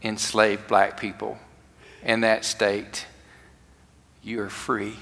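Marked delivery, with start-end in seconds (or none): 4.2-5.0 hushed announcement. Acted. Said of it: "gave the news".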